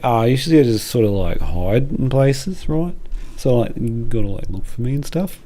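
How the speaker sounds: chatty Waldo bragging about hiding. Pretend. Waldo explains his hobby to a visitor, lightly voiced. Waldo answers in made-up scene.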